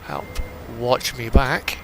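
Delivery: ordinary delivery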